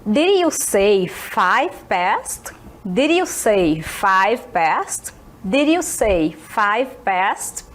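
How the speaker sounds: slow, clear English for learners; slow teaching read. Shown as a demonstration. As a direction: spell it out slowly for students. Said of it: "que ela falou assim?". (fala devagar e bem clara)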